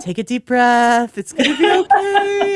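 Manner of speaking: hammed-up soothing, laughing through it; mock reassurance. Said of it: "And you're like".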